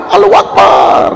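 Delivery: high hoarse cry of triumph; crowd shout. Retold or imitated. Debate crowd erupting in victory, shouted high.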